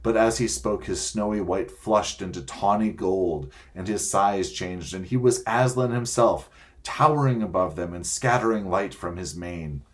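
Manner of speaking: plain reading voice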